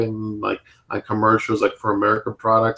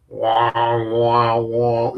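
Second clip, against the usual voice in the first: imitated didgeridoo drone; much slower than usual.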